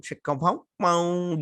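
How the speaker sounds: drawn-out, high "chicka-pong-pong"; sing-song mouth noise for a steamy scene; mimicked sexy music. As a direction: imitate cheesy make-out music.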